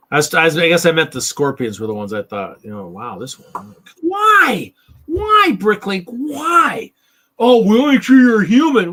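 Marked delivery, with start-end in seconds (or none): 4.0-6.9 high squeaky outraged "why"; mock outrage. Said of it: "it was like, "Why?". 7.4-8.9 loud, high mock-official voice; mocking the website. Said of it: "Well, we're trying to protect you".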